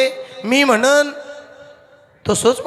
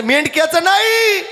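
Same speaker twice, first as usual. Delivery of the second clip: high, raised-voice denial; emphatic contradiction; 8 semitones higher than usual. Acted. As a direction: correct her with a rising cry. Tukoba जोरात विरोध करतात. (चढ्या आवाजात ठाम नकार)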